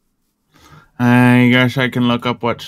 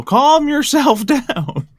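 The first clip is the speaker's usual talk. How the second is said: high slow teasing laughing; 13 semitones higher, much slower than usual. Acted. Repeat tease, slower.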